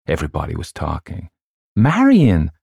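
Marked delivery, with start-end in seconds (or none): 1.8-2.5 high, loud call. A narrator's reading. Louise calls over everybody talking, calling out. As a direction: call the name over the chatter. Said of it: "called Louise".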